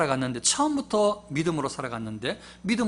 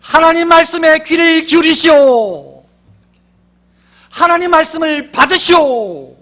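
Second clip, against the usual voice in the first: huge high booming proclamation, slow; prophet's thundering call to hear the word; shout; 13 semitones higher, 19 dB louder, slower than usual. Shown as a demonstration. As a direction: roar it like a prophet. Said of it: "이렇게 소리를 좀 질러보고 싶습니다".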